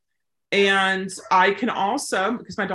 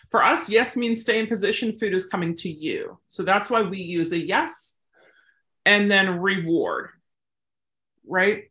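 ordinary talk, nothing marked

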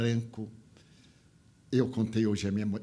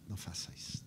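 softer, breathy whispered plea; 5 semitones lower, 14 dB quieter, faster than usual. Retold or imitated. Speaker answering the crying man, whispered and tender.

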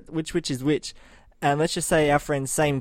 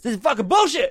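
high-pitched outraged mock yell; 9 semitones higher than usual. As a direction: blurt it out like your yelling mate. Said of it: "and just starts yelling".

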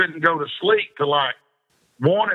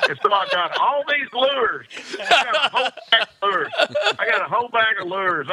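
high, laughing narration; 7 semitones higher than usual. Real, not acted. Storyteller laughing about his free lures, laughing. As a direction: tell it while cracking up.

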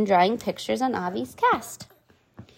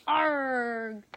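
soft pirate arg; 2 semitones higher, 8 dB quieter than usual. Shown as a demonstration. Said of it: "Everyone who wants to be a pirate, say".

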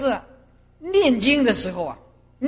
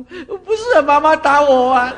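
put-on crying child; 3 semitones higher, 8 dB louder, slower than usual. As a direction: wail a protest like a smacked child. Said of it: "结果是哭他妈妈打他的". (模仿小孩大声哭诉)

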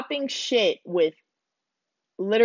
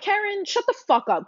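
much higher, sharp retort; retold telling-off; 11 semitones higher than usual. Narrator retelling how she shut the Karen down, sharp and high. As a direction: cut her off, pitched way up. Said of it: "So I was like".

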